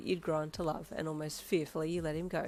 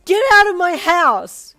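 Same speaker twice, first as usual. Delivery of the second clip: much higher, much louder slow scream; anguished scream; 12 semitones higher, 21 dB louder, slower than usual. A narrator's reading. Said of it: "you screamed in anguish".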